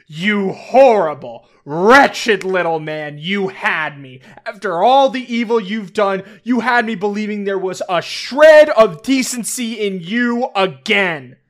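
loud, furious dressing-down; furious outburst. Acted. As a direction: tear into him with full fury. Hope tells off her brother.

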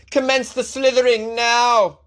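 slow, high, grand decree to the snakes; mock command. Host playing master, ordering the snakes in a slow mock decree. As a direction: ham up an order to start the slithering.

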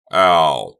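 loud scream of pain; shouting while wounded. Acted. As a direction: scream as the leg is hurt. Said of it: "Doug screamed in pain".

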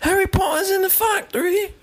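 hammed-up British "factory"; mock British accent.

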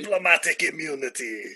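mock South African movie villain; South African accent. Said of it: "he must be South African".

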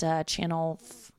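ordinary talk, nothing marked

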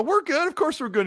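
high, bright mock reassurance; sing-song fake comfort. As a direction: overdo the friendly reply. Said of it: "I go".

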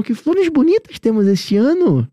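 high, slow, lilting line with a long ANO; theatrical sing-song demo, drawn out to "ano"; exaggerated intonation. Shown as a demonstration. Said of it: "pega a entonação".

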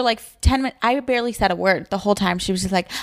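normal talking voice

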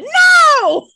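very high, loud drawn-out cry; hammed-up shout. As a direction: belt out an over-the-top refusal. Hammed-up refusal of returning character.

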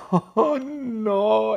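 high, drawn-out oh no; hammed-up horror; mock dismay. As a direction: lament the crash theatrically. Reacting to someone run over.

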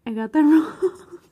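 high, loud, breaking into laughter; slow, laughing disbelief at yourself; real laughing. Real, not acted. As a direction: laugh through the admission. Laughs at own wrong answer.

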